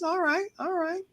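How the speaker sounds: high, soft all right; soft, high-pitched acceptance; retold tentative approval. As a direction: concede it gently in a lifted voice. Retelling his tentative approval of show.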